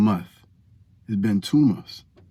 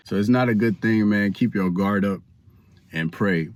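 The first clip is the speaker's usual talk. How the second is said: ordinary talk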